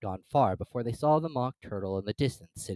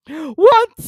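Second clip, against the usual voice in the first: loud, high mournful wail; hammed-up sighing wail; deep sigh; 16 dB louder than usual. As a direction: sigh the word out. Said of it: "with a deep sigh".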